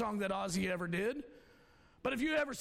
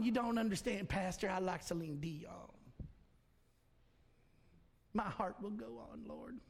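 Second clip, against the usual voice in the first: whiny congregant; 2 semitones lower, 4 dB quieter than usual. Retold or imitated. Imagined congregant pleading, then song.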